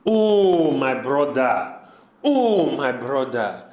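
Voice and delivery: drawn-out "oh", falling away low; sorrowful, reproachful sigh of an appeal; dismayed appeal. Acted. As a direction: lament to a brother, each phrase sinking. Dismayed reply to brother's plan.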